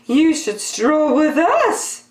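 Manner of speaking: high, showy invitation; a vain peacock strutting by; haughty peacock. Acted. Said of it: "said the peacocks".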